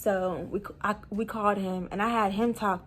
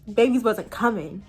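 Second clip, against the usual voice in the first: usual speaking voice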